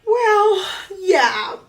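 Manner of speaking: high, loud, laughing admission; drawn-out, high-pitched mock reluctance; sheepish admission. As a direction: admit it through an embarrassed laugh. Acts out her sheepish admission.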